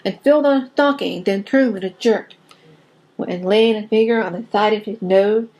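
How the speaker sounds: rhythmic storybook reading of a poem; story narration. Acted. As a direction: read it like a bedtime poem to children. Reading Christmas poem aloud.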